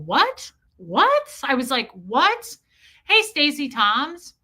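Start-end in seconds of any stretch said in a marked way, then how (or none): 0.0-2.6 retold disbelief.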